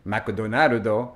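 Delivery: put-on "McDonaldo" in a slow Japanese accent, every syllable with its own vowel. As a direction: slowly say "McDonaldo" the Japanese way, Mac-Do-Na-Ru-Do. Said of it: "kept repeating".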